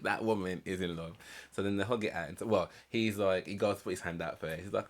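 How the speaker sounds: normal talking voice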